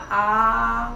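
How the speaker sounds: "आ" with the mouth fully open, loud; a loud, wide-open "आ". Shown as a demonstration. Teacher sounds "आ" loud for the class, mouth fully open. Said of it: "आ में मुंह पूरा खुला".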